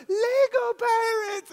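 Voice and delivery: put-on a child spotting pirate toys; thrilled child. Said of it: "she's like".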